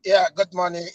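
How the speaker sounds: ordinary delivery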